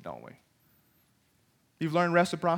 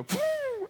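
party blower toot; 6 dB quieter than usual. Retold or imitated. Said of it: "they go".

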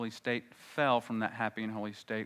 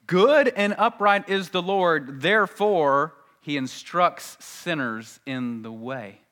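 loud, slow, raised proclamation easing down at the end; emphatic scripture reading; 6 semitones higher, 10 dB louder, slower than usual. Acted. Preacher drives home the psalm to congregation, emphatic. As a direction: proclaim the verse slowly and forcefully.

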